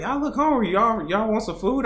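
high-pitched imitation of a friendly lady; put-on high Southern lady voice. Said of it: "this lady was like".

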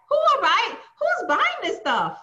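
who on earth buys this, high and disbelieving; incredulous. Real, not acted. Mocking Gucci buyers to the cohosts, high disbelief.

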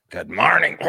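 lightly voiced raspy cartoon grandma; a touch of a Simpsons smoker grandma; Simpsons-style grandma voice. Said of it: "She talked like one of the Simpsons".

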